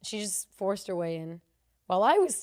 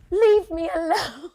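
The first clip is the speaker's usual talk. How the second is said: high, loud, drawn-out mock wail; hammed-up plea, breaking into laughter; mimicked outburst; 9 semitones higher, 10 dB louder, slower than usual. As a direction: imitate an exasperated woman crying out. Imitates woman snapping at her.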